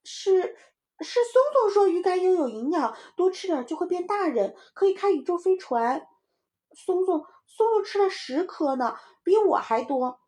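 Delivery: put-on high-pitched flustered kid; tattling little child. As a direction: shift the blame in a child's voice. (小孩高声急着告状)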